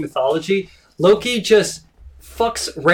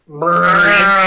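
louder rolled brrr growl; mock monster growl; 4 dB louder than usual. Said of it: "It yells at him".

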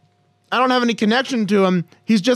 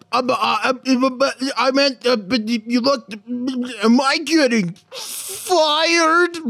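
higher, hammed-up nervous stammering; flustered stammer; 4 semitones higher than usual. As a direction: stumble over every word, mock-panicked. Acts flustered man backpedaling to boss.

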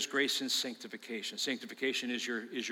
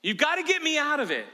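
desperate plea; 9 semitones higher, 7 dB louder than usual. Retold or imitated. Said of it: "where you're like".

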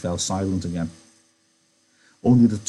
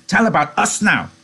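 put-on high, joyful childlike cry; happy cry; 12 semitones higher, 7 dB louder than usual. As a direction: cry out happily. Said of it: "Lenny cried happily".

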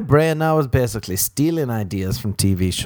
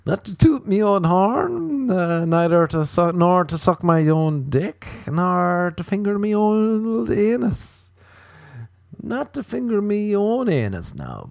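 high, slow sing-song phrasing; drawn-out silly riff; 7 semitones higher, slower than usual. Pretend. Comic riffing on "not to toot me own horn", high drawn-out mock-grand. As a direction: stretch each phrase out high and playful.

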